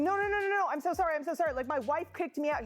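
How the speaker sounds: high-pitched imitation of frantic pleading; panicked apologizing. Woman mimics the caught gardener explaining himself, frantically apologetic. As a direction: imitate someone begging forgiveness in a rush of repeats. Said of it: "He starts going".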